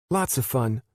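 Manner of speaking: put-on high, light little-girl voice. Addie answering Jim about the weekend, high little-girl voice. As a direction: chirp it like a happy small child.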